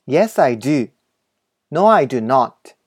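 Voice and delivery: slow, carefully spaced model English reading; お手本の英文音読. Shown as a demonstration. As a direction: read each sentence slowly for learners to repeat. (英語のお手本をゆっくり音読)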